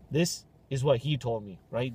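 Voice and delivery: usual speaking voice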